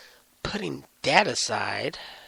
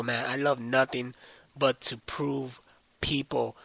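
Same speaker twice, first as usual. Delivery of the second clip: normal talking voice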